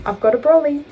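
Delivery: high, loud Australian impression; Australian accent. Repeating the brolly joke, high loud Australian accent. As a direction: belt it out in mock Australian. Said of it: "in an Australian accent".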